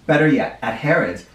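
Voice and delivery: ordinary delivery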